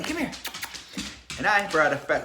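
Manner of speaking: ordinary delivery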